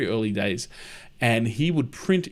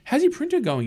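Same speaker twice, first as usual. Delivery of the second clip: a light, much higher pitch for his own question; high mock question. Recalling asking the friend about his printer, high and casual. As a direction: voice jumps up high, casual check-in. Retelling chat with asthmatic friend.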